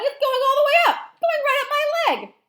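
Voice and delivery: very high, loud shriek; shrieking alarm at full volume; panicked yelling. Acted. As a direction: yell in rising comic panic. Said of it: "the man was yelling".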